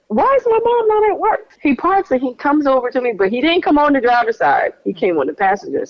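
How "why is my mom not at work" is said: alarmed surprise. Retold or imitated. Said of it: "And I'm like".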